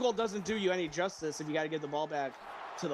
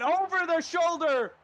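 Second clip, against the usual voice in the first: loud, high excited call; excited shout; 9 semitones higher, 6 dB louder than usual. Real, not acted. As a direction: yell the catch. Play-by-play of deep catch.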